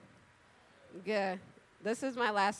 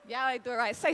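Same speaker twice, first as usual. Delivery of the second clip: usual speaking voice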